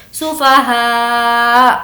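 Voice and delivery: very slow سفہا ending with a hamza catch; hamza stop. Shown as a demonstration. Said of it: "رکتے وقت حمزہ کی آواز نکالیں گے". (حمزہ پر جھٹکا)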